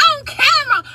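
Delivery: high, tearful mock wail; imitated crying. Reacting to news of a video, high mock crying. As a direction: wail it in disbelief, hammed up. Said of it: "Bitch, I'm crying again".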